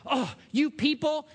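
lightly voiced teen daughter, annoyed; exasperated daughter. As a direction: sigh it out like a fed-up daughter. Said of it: "this is her expression".

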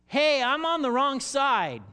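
loud, slow, high mock protest. A sheep protesting to the king, loud and high. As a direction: cry out in mock alarm.

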